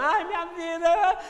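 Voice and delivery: high, drawn-out wail of self-pity; hammed-up tearful lament; mock crying. Teacher acts someone crying over their own life, mock wailing. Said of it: "na hora que você tá chorando".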